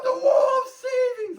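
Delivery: thrilled shopper screaming the wall of savings; hammed-up excited shout. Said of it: "I was like".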